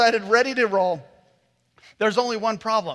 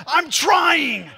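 higher, loud exasperated yell; shouting back; 8 semitones higher, 7 dB louder than usual. Retold or imitated.

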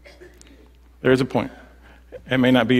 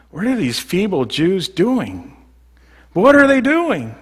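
high, sneering mockery; high mocking taunt; 7 semitones higher, slower than usual. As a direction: jeer at the feeble builders. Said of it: "He's mocking them".

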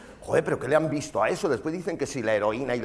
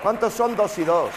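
mock interviewer; 8 semitones higher, 6 dB louder than usual. Interviewer question to footballer.

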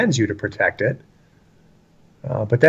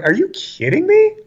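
very high-pitched disbelieving retort; acted incredulity. Preacher answering critics of his blunt speech, acted incredulity. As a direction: voice leaps up in exasperated disbelief.

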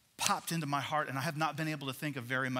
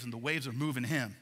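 usual speaking voice

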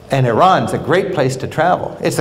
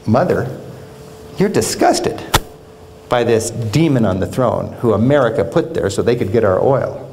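ordinary talk, nothing marked

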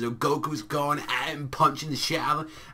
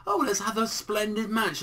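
lightly imitated polite villain voice, higher; a hint of a refined, higher character voice; Frost; 6 semitones higher than usual. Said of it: "same voice actor as Frieza".

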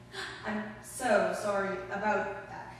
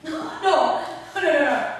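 loud laughing protest; 5 semitones higher, 10 dB louder than usual. Acted. Flustered visitor laughing off his blunder to a dying friend. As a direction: laugh it off loudly, embarrassed.

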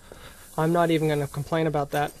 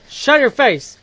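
loud, higher snapping yell; shout; 5 semitones higher, 11 dB louder than usual. Real, not acted. Gamer yelling at a monster to shut up. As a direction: bark it at the monster.

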